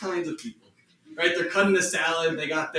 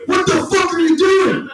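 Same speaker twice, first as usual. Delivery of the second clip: high, loud shout; yelling at the dog; 13 semitones higher, 7 dB louder than usual. Retold or imitated. Owner catching the dog eating poop, shouting. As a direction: yell at the dog caught in the act. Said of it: "I'm about to yap".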